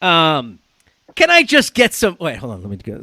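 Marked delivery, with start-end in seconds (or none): 1.2-2.2 high-pitched put-on Italian accent. Host starts the third quiz line, exaggerated Italian accent. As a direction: overdo the Italian-American accent. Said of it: "Sorry, my Italian accent".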